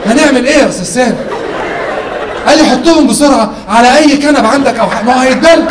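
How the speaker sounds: escalating comedy rant. Retold or imitated. Call dialogue building up.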